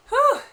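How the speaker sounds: high, loud sigh of relief; relieved whew. Real, not acted. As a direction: blow out the tension. Relief after danger.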